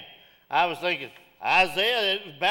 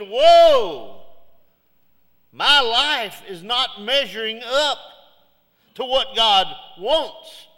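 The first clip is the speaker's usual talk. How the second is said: louder, slow anguished confession; loud anguished cry; 5 semitones higher, 10 dB louder, much slower than usual. Retold or imitated. Isaiah crying out to God, loud and slow.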